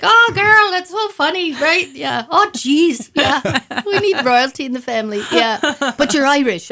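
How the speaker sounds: high, loud teasing relatives, sing-song mock; teasing family member. Family teasing their "queen" relative, high and loud mock voice. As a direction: play the giggling family ribbing her.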